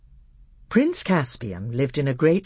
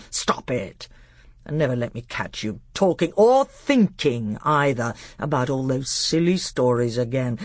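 stern, deliberate royal tirade; menacing uncle laying down the law; angry scolding. Acted. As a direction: forbid it with weighty emphasis. Angry uncle forbids the stories.

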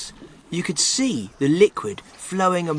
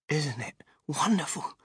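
put-on hushed, soft child's wonder; awed whisper; 6 dB quieter than usual. A narrator's reading. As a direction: whisper it softly in amazement. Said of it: "whispered Charlie".

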